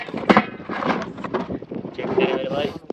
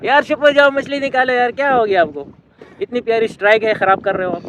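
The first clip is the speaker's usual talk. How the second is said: high, raised, exasperated appeal; loud pleading protest; 6 semitones higher, 6 dB louder than usual. Real, not acted. Telling laughing friends to hush and fish, loud protest. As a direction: beg them loudly to quit it.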